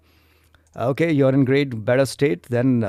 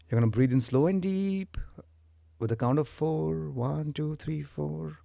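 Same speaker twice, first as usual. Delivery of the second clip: guided meditation; 4 semitones lower, 8 dB quieter than usual. Acted. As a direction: lead them calmly into the breath. Leads breathing meditation.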